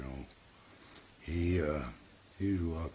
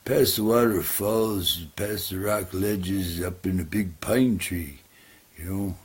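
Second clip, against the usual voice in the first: higher and louder, eager simple-minded raccoon; 4 semitones higher, 9 dB louder than usual. Acted. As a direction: play the trusting raccoon, bright and keen. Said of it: "He goes".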